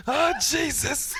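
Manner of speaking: high, stretched-out shock; mock horrified exclamation. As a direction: draw it out high, as if just caught. Acts out shock at being caught.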